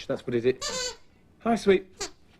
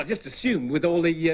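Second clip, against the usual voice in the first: usual speaking voice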